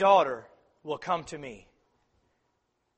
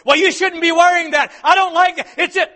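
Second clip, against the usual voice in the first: loud, high scolding snap; harsh critical parent; 12 semitones higher, 13 dB louder than usual. Retold or imitated. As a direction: scold the child sharply. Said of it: "It comes back to the attitude of".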